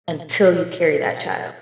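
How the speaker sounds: normal talking voice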